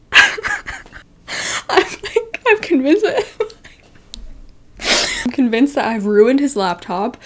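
high, laughing near-cry; panicked laugh. Real, not acted. Telling viewers about ruining his laptop, high laughing panic. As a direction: squeal it through laughter. Panic while retelling the spill.

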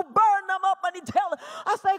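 normal talking voice

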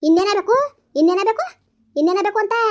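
insistent, rising challenge; angry retort. Acted. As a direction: throw the question back, rising each time. Acted family quarrel, retorting. (ಕೋಪದ ಮರುಪ್ರಶ್ನೆ)

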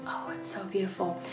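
normal talking voice

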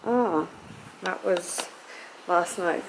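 ordinary talk, nothing marked

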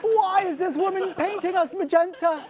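high, loud wail of a doomed roach; mother roach's wail. Acted. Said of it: "asking themselves".

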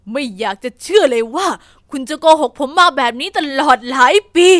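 loud, furious accusation of betrayal; a betrayed husband shouting at his wife; angry disbelief. Acted. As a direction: hurl it at her, stunned and angry. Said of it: "ด้วยความโกรธ".

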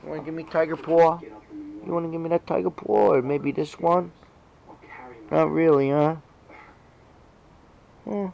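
high-pitched coaxing; coaxing pet talk. Real, not acted. Owner asking the cat for a paw, high coaxing. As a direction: ask sweetly, high, as to a pet.

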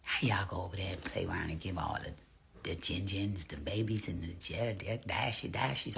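unvoiced hushed whisper; low secretive whisper. Real, not acted. Hushed aside in chat.

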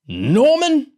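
high, loud, affronted growl. A narrator's reading. Man offended at his first name, growling. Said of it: "Large growled".

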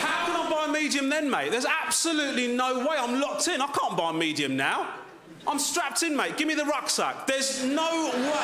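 high, frantic complaint; exasperated rant. Real, not acted. Ranting about medium popcorn.

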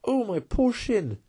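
high, slow wail of pain; hurt animal's drawn-out lament; pained cry. A narrator's reading. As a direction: cry out high, dragging the words. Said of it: "he cried".

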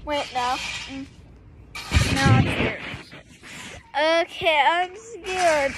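ordinary talk, nothing marked

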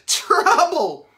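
ordinary delivery, nothing marked

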